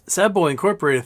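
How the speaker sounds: usual speaking voice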